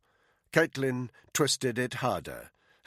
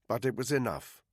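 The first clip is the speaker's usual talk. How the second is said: ordinary delivery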